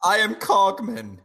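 high, put-on robot butler. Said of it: "Yeah, Cogman".

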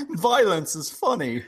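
high, put-on robot butler. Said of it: "I'm a robot butler".

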